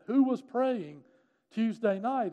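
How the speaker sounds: high, loud insistent question; retold daughter's urgent demand; emphatic demand. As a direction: repeat it forcefully, pressing for an answer. Daughter repeats her question insistently.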